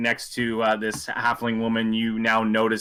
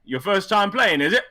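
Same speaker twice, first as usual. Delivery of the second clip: louder, higher sly welcome; friendly dealer; 6 semitones higher, 4 dB louder than usual. Acted. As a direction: greet the mark with a grin. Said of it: "he turns to you and goes".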